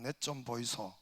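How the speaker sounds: light Gyeongsang accent, casual request; softly voiced Gyeongsang dialect. Shown as a demonstration. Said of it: "경상도 말로는".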